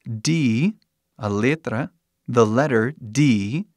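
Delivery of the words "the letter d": the letter D said slowly in English; careful English. Shown as a demonstration. Teacher reading the letter D slowly in English. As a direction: spell it out slowly, English style. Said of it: "se pronuncia".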